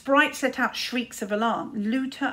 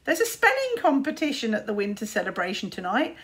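taunting; 3 semitones higher, 6 dB louder than usual. Acted. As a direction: rub his face in it. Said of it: "taunted Luther".

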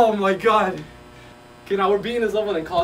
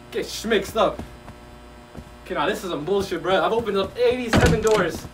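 ordinary talk, nothing marked

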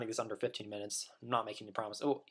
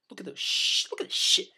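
excited; 6 semitones higher, 4 dB louder than usual. Retold or imitated. Excited fanboy reaction quote.

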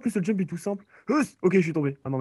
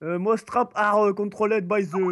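put-on higher-pitched, halting English accent; English accent attempt; 6 semitones higher, slower than usual. Showing off an English accent on the game's rules, halting. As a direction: read it out slowly in a clumsy English accent. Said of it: "c'était mon accent anglais".

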